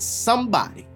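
usual speaking voice

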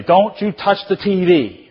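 high, loud, slow scolding; stern, emphatic household rule; strict parental warning. Acted. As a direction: lay down the law to a toddler. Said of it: "the way you do that is".

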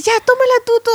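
urgent instruction. Retold or imitated. Midwife tells father take baby.

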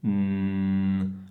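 long, low, steady N-like hum; sustained nasal hum. Shown as a demonstration. Teacher shows the sound can be prolonged, sustained hum. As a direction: hold the nasal consonant without a vowel. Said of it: "io lo posso prolungare questo suono".

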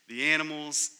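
normal talking voice

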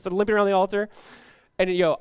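normal talking voice

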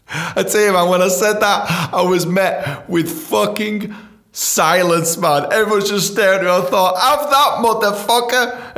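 high, loud gloating telling; triumphant. Real, not acted. Telling how he silenced the room, gloating high and loud. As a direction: crow about the win, slowly.

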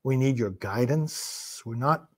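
measured, low-key praying voice; solemn prayer. Real, not acted. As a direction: address God humbly, pausing between phrases. Leads a prayer.

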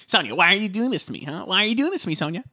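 high, rapid imitation of pleading indignation; mock protest. Imitating Ramona challenging Sonia for laughing, mock protest.

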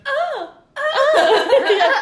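loud, high-pitched mock "oh" cries; acted-out reaction. Co-host showing their reaction to Shawn Michaels, loud mock outcry. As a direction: cry out twice, high and hammed-up. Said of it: "My reaction to that would have been".

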